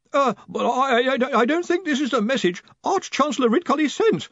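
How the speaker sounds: high, stammering squeak; squeaky with nervousness. A narrator's reading. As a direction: stammer bad news to your superior. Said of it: "his voice gone squeaky with nervousness".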